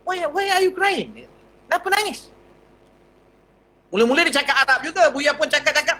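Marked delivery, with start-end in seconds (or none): 0.1-2.3 concerned stranger asking. Retold or imitated. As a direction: ask gently why he is crying. (suara orang asing yang prihatin, tinggi dan perlahan)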